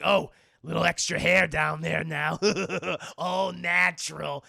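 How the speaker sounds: loud, put-on creepy demon chuckling; leering villain. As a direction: leer loudly with a villain's laugh. Violator leers in Wanda's body.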